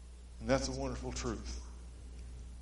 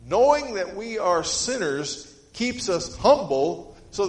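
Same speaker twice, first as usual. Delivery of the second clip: loud, slow, emphatic sermon delivery; fervent preaching; 3 semitones higher, 7 dB louder, slower than usual. Acted. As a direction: drive each phrase home to the church. Preaching at full force.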